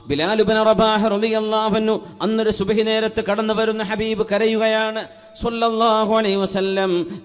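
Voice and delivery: ringing sermon delivery with sustained phrase endings; projected preaching. Real, not acted. Preacher urging believers to thank Allah, projected sermon voice. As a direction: preach it out to a whole congregation. Preacher tells story of Bilal.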